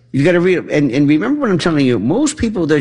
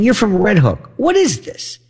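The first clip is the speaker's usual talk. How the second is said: incredulous disbelief; 8 semitones higher than usual. Retold or imitated. Mocking the fake Irish accent.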